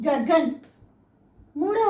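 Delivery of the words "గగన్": "గగన్" ending on a bare n, louder; "గగన్" as two syllables, final a dropped; half third letter. Shown as a demonstration. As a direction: say "గగన్" with no vowel after the n. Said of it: "third లెటర్ని మనం".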